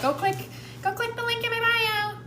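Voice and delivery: high, pushy influencer sales voice; salesy pitch voice. Acted. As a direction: hype it like a cheesy saleswoman. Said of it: "That's real versus".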